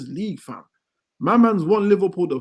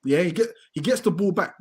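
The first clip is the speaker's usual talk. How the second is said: ordinary delivery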